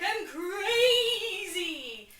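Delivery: slow, high, loud crying wail; wailed madness. Retold or imitated. Hamlet wailing madness at Polonius. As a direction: howl it like a hammy lunatic. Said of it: "Hamlet's all like".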